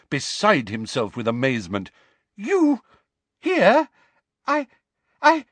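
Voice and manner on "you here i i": high, stuttering astonished minister; stammering Fudge. Acted. Stunned minister stammers at Dumbledore, high. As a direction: stumble over the words.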